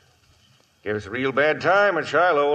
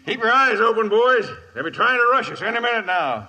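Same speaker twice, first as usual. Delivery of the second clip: barked order; 8 semitones higher than usual. Acted. Sergeant warning his men of an attack. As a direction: order the men to stay alert.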